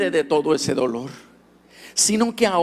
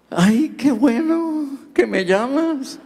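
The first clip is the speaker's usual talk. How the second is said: higher, slow, overjoyed son; lightly voiced grateful son; delighted son; 9 semitones higher, slower than usual. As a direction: stretch it out in happy disbelief. Son overjoyed at father's call.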